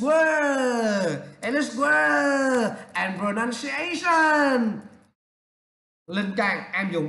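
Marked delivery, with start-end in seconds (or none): 0.0-4.8 very slow, drawn-out syllables; exaggerated English. Shown as a demonstration. Teacher showing what films teach, exaggeratedly drawn out. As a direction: stretch each English word out, swooping high.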